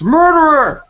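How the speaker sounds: high, loud shriek of murderer; screamed accusation. Acted. Redhead screams at Greg over her dead owl. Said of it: "The red screams".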